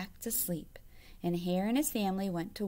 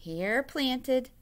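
usual speaking voice

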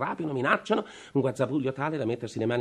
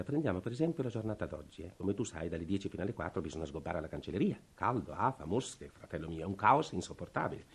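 normal talking voice

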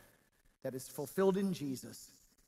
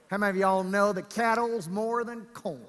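drawling West Texas accent; 4 semitones higher, 5 dB louder than usual. Shown as a demonstration. Preacher asks congregation about cattle, in West Texas accent. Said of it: "with a West Texas accent right there".

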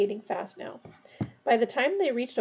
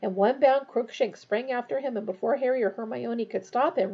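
ordinary delivery, nothing marked

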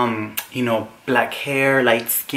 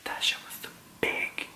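hushed, fully whispered aside; shocked whisper; 14 dB quieter than usual. Retold or imitated. Reacting after the guy moves his hand, shocked whisper. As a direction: whisper it in mock awe.

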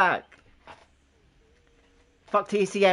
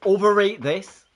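loud, slow challenge; defiant retort; 5 semitones higher, 8 dB louder than usual. Real, not acted. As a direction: throw it back at them loudly.

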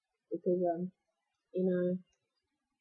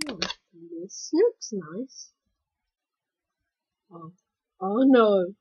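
ordinary talk

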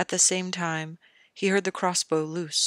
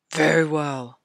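gruff king; 6 dB louder than usual. Acted. King Robert's voice.

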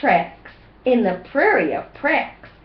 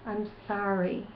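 put-on much softer, gentle sorry for the kids to copy; hushed, tender model apology; 10 dB quieter, faster than usual. Shown as a demonstration. Parent showing kids how to say sorry, softly. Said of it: "Go ahead and say".

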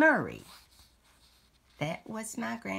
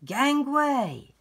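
pirate shout; 7 semitones higher, 6 dB louder than usual. Pretend. Pirate line in the book.

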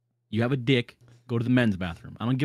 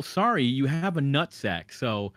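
ordinary talk

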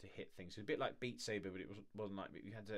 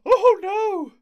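much higher, very loud excited scream; thrilled scream; 23 dB louder than usual. Real, not acted. Streamer overwhelmed by the trailer news, screaming. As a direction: scream it out in delight.

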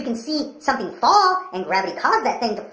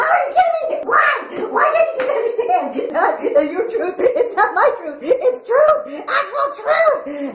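high, loud, laughing disbelief; plead, why don't you get it; incredulous laughing; 9 semitones higher, 3 dB louder, much slower than usual. Acted. Pastor to kids, incredulous and laughing about truth.

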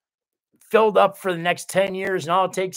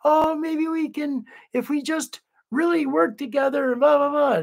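mock naive optimist; 10 semitones higher than usual. Mocking his former beacon-of-hope self to chat, high sing-song. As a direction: make his old hopeful self sound silly and naive.

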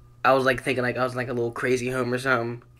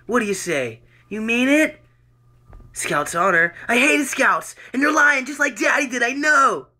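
loud, higher two-voice dramatic reading of a doctor grilling Joe; acted doctor and patient; 10 semitones higher, 6 dB louder than usual. Reading his jail piece aloud, doctor questioning Joe, loud and higher. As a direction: play both doctor and troubled Joe, loud and raised.

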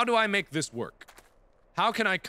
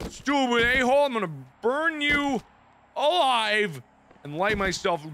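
scared horror game streamer; 9 semitones higher, 3 dB louder than usual. Real, not acted. Ranting at the game after scare.

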